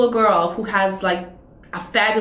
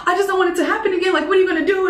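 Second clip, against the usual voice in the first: imitating panicked mom; 11 semitones higher, much faster than usual. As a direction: ham up a frantic worried mother.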